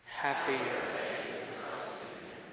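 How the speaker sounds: faint congregation murmur far off; a room answering back quietly; distant crowd response. Real, not acted.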